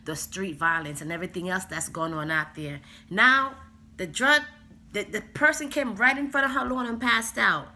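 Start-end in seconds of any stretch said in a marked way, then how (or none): 3.1-7.7 much higher and louder, outraged; loud disbelief at what happened; indignant outburst. Real, not acted. Telling viewers about the kid passed out on the lawn, loud and indignant.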